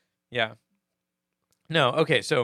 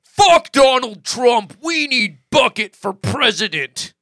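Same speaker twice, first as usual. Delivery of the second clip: mock rallying shout; 6 semitones higher, 8 dB louder than usual. Reading fan comment aloud.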